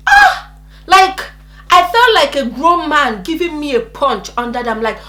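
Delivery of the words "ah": high, loud startled "ah"; sudden cry of pain, acted out; re-enacted scream. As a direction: yelp as if punched. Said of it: "And I screamed".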